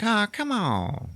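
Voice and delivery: high, drawn-out mock coaxing; wheedling plea. Talking her into going out, wheedling. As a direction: stretch it out, begging playfully. Acted pleading for a date.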